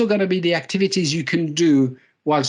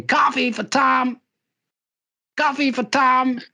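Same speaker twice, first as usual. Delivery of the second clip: barista calling out the name, higher and slow; 9 semitones higher, slower than usual. Retold or imitated. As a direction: call the order across the shop, high and drawn out. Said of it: "this barista lady with a cup going".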